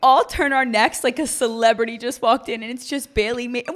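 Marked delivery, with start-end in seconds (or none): none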